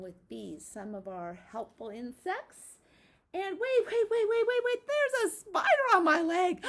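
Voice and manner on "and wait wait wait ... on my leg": hammed-up panic, high and loud; playful fake fright for the kids; mock alarm. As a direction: pretend a spider is on your leg. Teacher fakes spider on leg.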